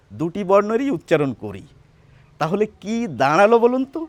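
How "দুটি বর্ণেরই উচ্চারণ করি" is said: ordinary talk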